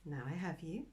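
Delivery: very soft, careful murmur; hushed tenderness. Acted. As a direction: speak gently to a tiny fragile creature. Catching a fragile butterfly.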